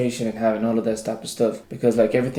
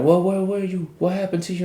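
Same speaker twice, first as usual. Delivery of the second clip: higher, questioning mother voice; worried mama; 7 semitones higher than usual. Retold or imitated. Imagined mama grilling her son who came home late. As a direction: pester him like a worried mom.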